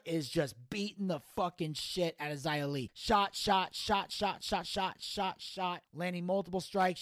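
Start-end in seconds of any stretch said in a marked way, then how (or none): 2.9-5.8 punchy repeated hits, stepping down in volume; staccato blow-by-blow. Retold or imitated. Commentator recreating the champion's flurry of strikes, staccato. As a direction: count off each strike in short bursts.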